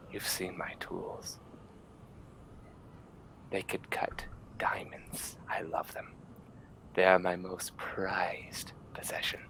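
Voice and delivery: slow, eerie whisper; creepy whisper. Acted. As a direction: whisper lovingly about the tools, slowly. Doctor whispers about his tools.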